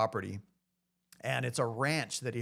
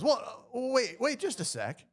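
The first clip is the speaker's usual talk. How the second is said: higher, hurried plea of a rancher who changed his mind; imitating eager rancher; 11 semitones higher than usual. Rancher calling the agent back, higher and hurried. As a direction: play the rancher suddenly keen to stop him.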